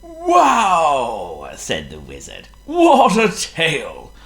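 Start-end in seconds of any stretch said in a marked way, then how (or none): 0.0-1.5 higher, louder, wondering wow; a wizard struck by a sight; mildly voiced wonderstruck wizard; amazed wizard. 2.7-4.1 higher, louder, wondering wow; a wizard struck by a sight; mildly voiced wonderstruck wizard; amazed wizard.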